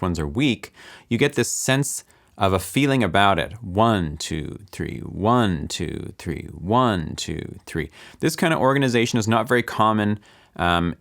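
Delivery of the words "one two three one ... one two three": "One" full, "two, three" much lower and softer; "One" strong, "two, three" dropped to a murmur; triple accent. Shown as a demonstration. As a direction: lean on "One", let "two, three" almost vanish. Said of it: "which ones are accented".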